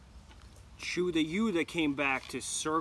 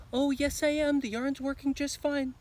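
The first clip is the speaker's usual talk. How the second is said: put-on much higher, louder girlish voice; girl's voice; 11 semitones higher, 7 dB louder than usual. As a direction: cub faking a little girl's voice. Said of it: "changed his voice and he answered back in the girl's voice".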